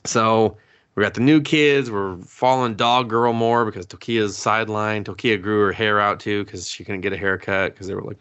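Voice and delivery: ordinary talk, nothing marked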